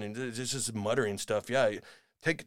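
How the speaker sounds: ordinary delivery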